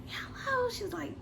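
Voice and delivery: high, urgent mom voice; imitating mom calling the ambulance; mom on the phone. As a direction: mom telling the ambulance to hurry.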